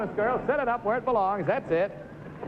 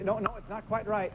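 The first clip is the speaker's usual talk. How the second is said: usual speaking voice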